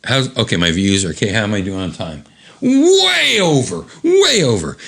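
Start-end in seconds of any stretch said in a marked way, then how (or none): 2.6-4.8 high, slow mock dismay; high drawn-out way over; joking high. Real, not acted. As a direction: wail that time's way over. Joking about time.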